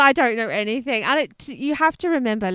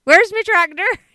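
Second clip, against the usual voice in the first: higher, louder mock West Country farmer; West Country accent; 6 semitones higher, 6 dB louder than usual. Co-host trying out farmer lines, West Country accent. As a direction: have a go at West Country burr. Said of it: "Please give us some West Country".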